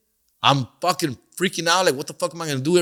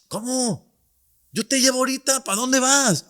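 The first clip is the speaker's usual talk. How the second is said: neighbor's voice; 7 semitones higher than usual. Retold or imitated. Paisa neighbor offers his truck.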